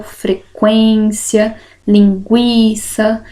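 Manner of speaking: frequência, linguiça spelled out slowly, syllable by syllable; teacher drawing out example words. Shown as a demonstration. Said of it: "as palavrinhas qual".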